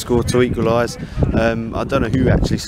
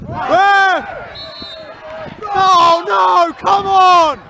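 yelling from the sidelines; much slower than usual. Real, not acted. Fan shouting at football match.

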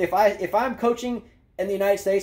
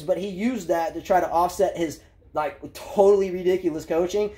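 usual speaking voice

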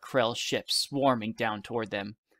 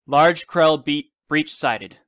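loud, higher radio call-out; urgent alert; 4 semitones higher, 8 dB louder, much slower than usual. A narrator's reading. Woman announcing an enemy breach over radio, urgent. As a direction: call the sighting out to all listening.

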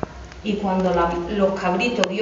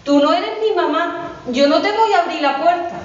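put-on higher kid-goat voice turning him away, slower in the middle; higher, slow little goat saying the voice is wrong; brave little goat; 7 semitones higher, slower than usual. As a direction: refuse the wolf like a plucky little goat. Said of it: "le dice el cabrito".

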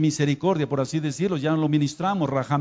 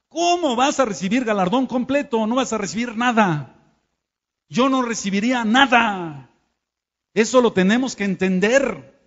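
fiery preaching; 5 semitones higher, 3 dB louder than usual. Real, not acted. Preacher rebukes the congregation.

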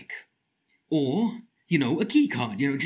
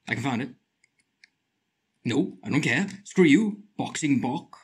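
usual speaking voice

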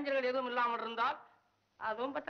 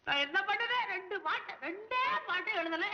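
high, plaintive protest; helpless lament; 7 semitones higher than usual. Acted. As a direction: wail your helplessness to the air. தனியாக ஆற்றாமையில் புலம்பல். (ஆற்றாமையுடன் உயர்ந்த குரலில் புலம்பல்)

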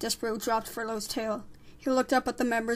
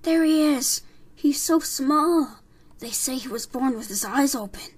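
excited gossip; 3 semitones higher, 6 dB louder than usual. Acted. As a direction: exclaim as an astonished crowd member. Gossiping crowd of mice marvels.

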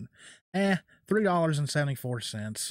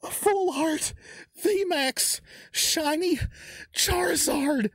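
very high, gasping, squeaky disbelief; overwhelmed shock; slower than usual. Real, not acted. Collector pulling a shiny Charizard, shocked high gasps. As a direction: gasp and squeal, barely getting the words out. Pulls the set's biggest card.

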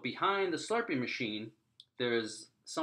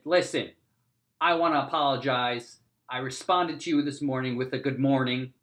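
loud public apology; 2 semitones higher, 6 dB louder than usual. Retold or imitated. Loud apology to whole office.

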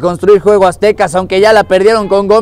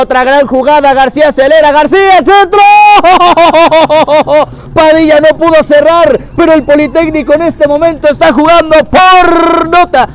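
rising, louder, faster call; excited commentary; 8 semitones higher, 5 dB louder than usual. Real, not acted. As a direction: build the call as the attack grows. Attack builds to cross.

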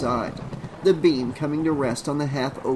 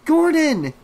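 loud call pitched way up; yell; 8 dB louder than usual. A narrator's reading. Trish calls into empty rooms for her dog, loud high yell. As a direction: holler for someone missing. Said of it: "she yelled".

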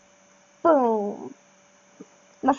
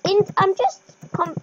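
ordinary talk, nothing marked

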